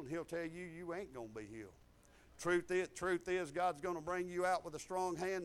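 normal talking voice

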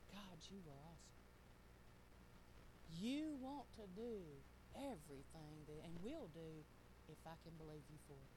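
hushed inward prayer. Retold or imitated. Preacher retells a thought to God, hushed. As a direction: recall an awed thought, kept quiet.

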